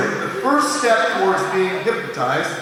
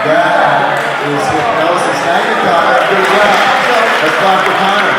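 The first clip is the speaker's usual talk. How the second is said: showman proclaim; 8 dB louder than usual. Real, not acted. Showman hails volunteer to crowd.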